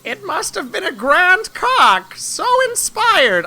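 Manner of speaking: high, loud, drawn-out rooster declamation. Acted. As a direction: crow it slowly like a pompous rooster. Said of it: "I'm gonna do this rooster voice".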